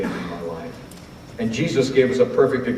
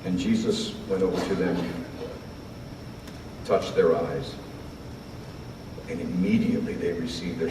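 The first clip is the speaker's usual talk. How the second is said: hushed; 5 semitones lower, 11 dB quieter than usual. Acted. Hush after climax, softened.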